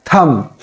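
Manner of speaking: "thumb" with a silent b; "thumb" with no b at the end; corrected thumb. Shown as a demonstration. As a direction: say "thumb" as tum, ending on m. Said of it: "It is totally silent".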